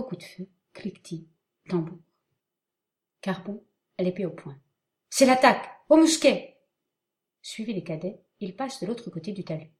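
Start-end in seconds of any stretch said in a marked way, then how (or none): 5.1-6.6 high, loud battle orders; shouting commands. Acted. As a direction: bark orders to the troops.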